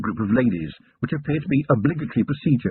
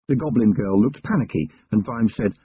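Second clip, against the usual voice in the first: ordinary delivery, nothing marked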